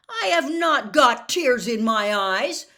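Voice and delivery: loud, slow, indignant denial. Acted. Harry loudly denying the reporter's claim about tears, indignant shout. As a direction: push back hard, each word loud. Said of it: "said Harry loudly".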